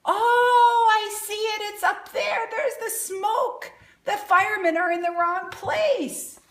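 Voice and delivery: high, sing-song spotting voice; excited discovery. Acted. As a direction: point it out with delight. Spotting the fire in picture.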